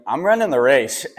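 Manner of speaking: excited, defiant shout of resolve; fired-up insistence. Retold or imitated. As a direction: declare it like nothing will stop you. Defiant retold reply to team.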